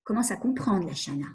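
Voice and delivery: the unconscious quietly approving her; inner voice. Pretend. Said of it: "l'inconscient me dit".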